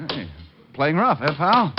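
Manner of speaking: normal talking voice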